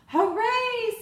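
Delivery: high, loud cheer. Acted. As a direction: shout hooray with joy. Amelia cheers.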